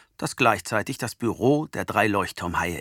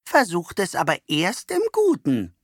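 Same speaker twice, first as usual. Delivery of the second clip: admonishing; 7 semitones higher than usual. A narrator's reading. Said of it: "mahnt Oma Rosella".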